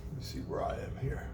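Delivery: whisper. Real, not acted. Teacher checks notes aside.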